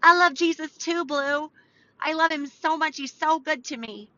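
high, playful fan voice; gushing believer. Pretend. Host imitating listeners who love Jesus, high and gushing. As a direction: gush in a high put-on voice. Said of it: "I think they're like".

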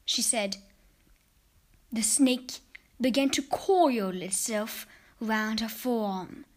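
plain reading voice

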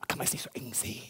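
sly unvoiced whisper of a dodge; mock whisper. Evasive Bible reader muttering an excuse, whispered.

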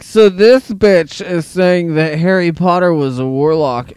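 put-on high, slow, loud drunk whine; mock drunk rant. Friend mocks the drunk guy at the bar with a high slow whine. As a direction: drag it out like a sloppy drunk. Mocking drunk guy's complaint.

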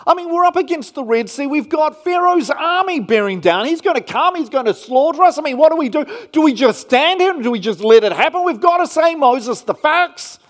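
loud, high, fast panicked pleading; panicked Israelites. Retold or imitated. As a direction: voice the frightened crowd crying out to Moses. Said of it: "it would be fair enough to ask".

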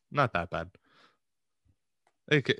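ordinary talk

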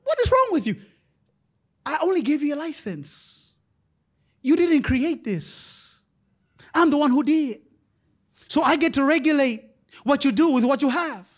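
high, loud stern rebuke; voicing God. God rebuking his steward, high and stern. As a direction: scold like a disappointed father. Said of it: "God is saying".

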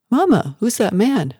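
put-on a boy tugging at his mother; child's voice. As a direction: ask like a curious small child. Young son asks mother.

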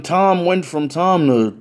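ordinary delivery, nothing marked

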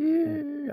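high-pitched, drawn-out fake "eee"; mock grinning squeal. Refusing to go around like a buffoon, mock grinning squeal. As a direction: squeal through a put-on buffoon grin.

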